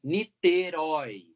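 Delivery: Niterói slowly, RÓI stretched out; Niterói as a slow stress demonstration; stress on last syllable. Shown as a demonstration. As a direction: draw out the final RÓI of Niterói. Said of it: "a sílaba tônica forte é a oxítona".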